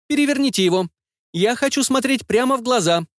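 put-on high boy's voice, cold and bossy; spoiled kid giving orders; child's voice. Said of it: "Пацан с детским".

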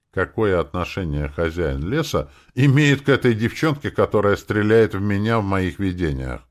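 usual speaking voice

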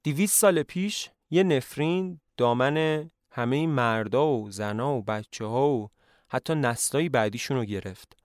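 ordinary delivery, nothing marked

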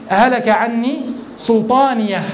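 هلك عني سلطانية recited slowly, ending on a sounded ha; slow Quranic recitation. Shown as a demonstration. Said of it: "في قوله".

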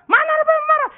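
very high, loud mock yell; retold angry denial. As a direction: deny the anger while yelling it, for comedy. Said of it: "marah-marah". (meniru teriakan marah sambil menyangkal)

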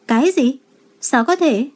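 a touch of alarm, pitch lifted; urgent disbelief. A narrator's reading. Mother reacting to bad news on the phone, urgent. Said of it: "có chút cấp bách".

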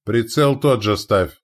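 deliberate word of command. A narrator's reading. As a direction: give the gunners their setting firmly. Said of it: "скомандовал Илья Ярилович".

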